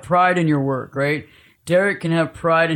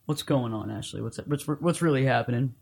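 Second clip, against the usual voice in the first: soft, low, caring question; gentle concern; 3 semitones lower, 6 dB quieter than usual. Retold or imitated. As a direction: ask it gently, calming things down. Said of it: "And you go".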